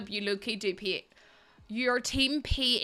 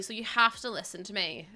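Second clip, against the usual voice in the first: ordinary delivery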